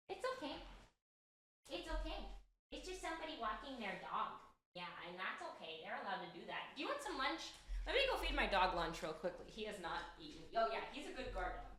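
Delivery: far from the microphone; talking from across the room; off mic. Real, not acted.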